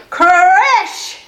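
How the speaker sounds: high, loud, drawn-out exclamation; loud crash effect. Acted. Storyteller as the roof caves in, loud crash. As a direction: burst out with the word like the impact.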